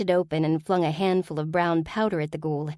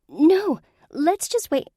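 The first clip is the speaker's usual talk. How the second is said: alarmed protest; 12 semitones higher, 6 dB louder, slower than usual. Acted. Witch protests fairy summons.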